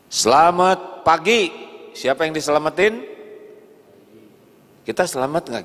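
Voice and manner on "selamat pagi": higher, louder, slowed good morning; showy greeting. Shown as a demonstration. Preacher mimics a polite office greeting, drawn out. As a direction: sing out the greeting for show.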